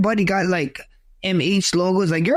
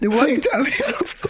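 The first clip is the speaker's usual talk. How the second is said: laughing retort; 9 semitones higher than usual. Retold or imitated. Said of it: "He's like".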